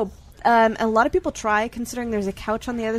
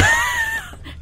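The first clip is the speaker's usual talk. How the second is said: high squealing burst of laughter; shrieking laugh; 3 dB louder than usual. Real, not acted. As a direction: let out a shrill laugh.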